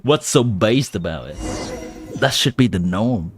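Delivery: ordinary talk, nothing marked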